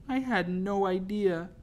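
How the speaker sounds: high, slow tearful protest; slow, wounded high voice. A narrator's reading. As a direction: plead through rising tears. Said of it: "My tears began to fall again".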